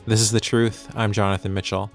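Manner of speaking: ordinary talk, nothing marked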